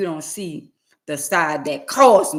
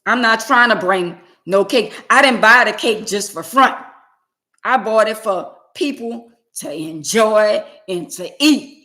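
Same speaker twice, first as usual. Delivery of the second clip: louder, pushing each point harder; emphatic rant; 4 semitones higher, 7 dB louder than usual. Real, not acted. Vlogger telling viewers off about cakes nobody cuts, loud and emphatic. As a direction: insist the cake is for sharing.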